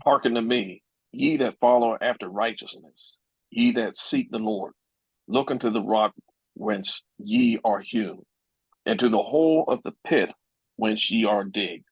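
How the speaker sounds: ordinary talk, nothing marked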